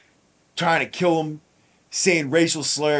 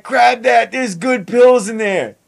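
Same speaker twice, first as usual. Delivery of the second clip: calling out; 7 semitones higher, 5 dB louder, slower than usual. Retold or imitated. Calling out to inmates on tier.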